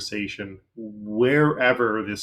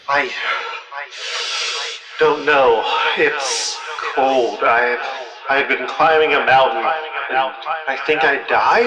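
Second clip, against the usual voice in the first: echoing ghostly voice, louder; cool echo effect; 3 semitones higher, 6 dB louder than usual. Acted. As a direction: voice calling through an echo. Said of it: "cool echo effect on this voice".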